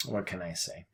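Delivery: low, soft, defeated mumble; quiet shrug in the voice; muttered resignation. Retold or imitated. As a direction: mutter it like you've given up.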